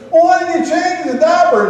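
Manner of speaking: mock-indignant mother confronting the dad; hammed-up wife demanding an answer; exasperated wife. Wife scolding husband.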